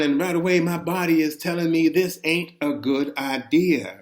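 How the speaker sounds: plain reading voice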